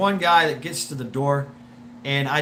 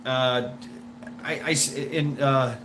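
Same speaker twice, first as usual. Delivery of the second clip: ordinary talk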